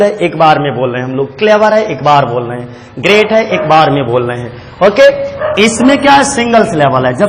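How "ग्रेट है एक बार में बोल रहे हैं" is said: ordinary delivery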